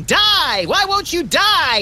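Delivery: high, drawn-out mock scream at a purse snatcher; retold scream. Max recalling beating a purse snatcher, mock yell. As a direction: yell it slowly while battering someone. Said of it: "and screaming".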